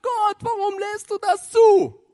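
high, slow, anguished mock cry; crying out to God. Paul and Silas in prison crying out to God, high anguished wail. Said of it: "die haben wahrscheinlich zu Gott geschrien".

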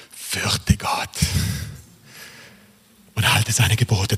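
reverent near-whisper; breathy, solemn whisper with pauses; hushed reveal. Acted. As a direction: let the verse land in a slow hush. Solemn reveal of the key verse.

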